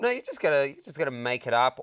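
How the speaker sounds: ordinary talk, nothing marked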